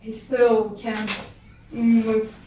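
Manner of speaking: ordinary delivery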